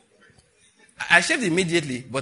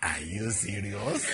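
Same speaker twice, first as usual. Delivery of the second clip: low, slow, stretched-out disbelief; finta incredulità; 7 semitones lower, 6 dB quieter, much slower than usual. Retold or imitated. Acts out his stunned reply.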